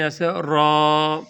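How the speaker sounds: را dit lourd et plein, lent, bouche arrondie; heavy ra. Shown as a demonstration. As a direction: pronounce را thick and full, slowly. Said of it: "موٹا کر کے پڑھتے ہیں".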